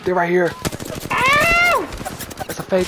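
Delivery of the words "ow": high loud yelp of pain; sudden high cry; sharp yelp. Real, not acted. Hit in game, yelps.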